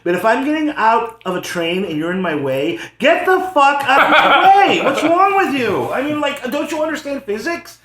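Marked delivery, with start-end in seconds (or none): 3.0-4.8 slow, high, loud outburst; exasperated yell. Acted. Commuter yells at a stranger blocking the train door, exasperated shout. 4.8-7.8 high, raised-voice exasperation; exasperated rant. Acted. Commuter berates a stranger who won't move, exasperated rant. Continues rant at blocking passenger.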